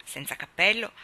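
usual speaking voice

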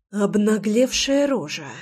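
slow hissed insult. Acted. Pinned wife hisses an insult at husband, hissed. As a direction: hiss it at him with contempt. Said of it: "прошипела Руслана".

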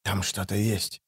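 very soft, low warning; hissed warning. A narrator's reading. Sergeant sensing something in the dark, hissing low. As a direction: keep it hushed and low. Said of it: "прошипел тот".

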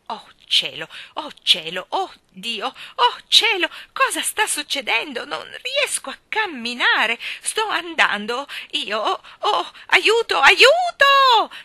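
rising into a high, panicked shriek for help; frightened old lady calling aiuto as she lifts off; panicked cries for help. Acted. Miss Persimon floats up, panicking.

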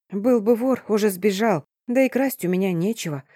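ordinary delivery, nothing marked

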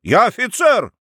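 high, forceful protest; indignant. A narrator's reading. Grown son insisting on his rank, indignant. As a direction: assert it hotly, pitched high. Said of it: "возмутился Феофил".

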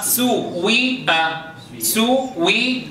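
سوء drawn out very slowly with a rounded u; very slow original form. Shown as a demonstration. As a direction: stretch the word سوء slowly to show the root. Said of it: "سيئة ما أصلها؟". (نطق سوء ببطء شديد)

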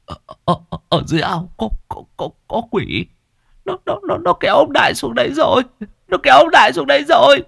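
frightened babbling; terrified stammer. Acted. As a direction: stutter in fear, rising to panic. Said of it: "Thằng Ngưu lắp bắp".

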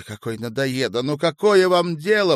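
high, loud irritated challenge; exasperated. A narrator's reading. Woman to meddling man, exasperated. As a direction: build into an angry question. Acted quarrel.

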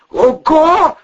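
high, loud gasp of alarm; hoarse exclamation. A narrator's reading. Man hearing the door shudder, hoarse. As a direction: croak it out in shock. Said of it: "просипел Волына".

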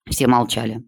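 ordinary delivery, nothing marked

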